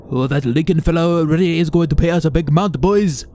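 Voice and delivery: hammed-up accented villain voice, fast and loud; mock Japanese man. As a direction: play a gleeful thug boasting. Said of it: "One of the men were talking in Japanese".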